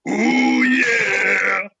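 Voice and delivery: a quick [name]-type ooh yeah; [name]-style ooh yeah. Retold or imitated. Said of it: "like, a deep".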